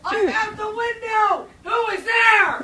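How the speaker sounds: high, drawn-out shout; yelling out window. Real, not acted. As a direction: holler slowly to someone outside. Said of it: "and then you yelled at".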